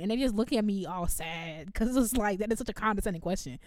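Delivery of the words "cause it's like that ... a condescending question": ordinary talk, nothing marked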